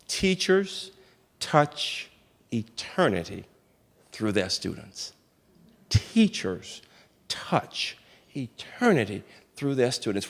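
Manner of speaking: very soft, slow, word by word; quiet, solemn quote with pauses between words; hushed reverence. Retold or imitated. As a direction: pass on a profound truth almost in a whisper. Retells mother's profound last gift, reverently.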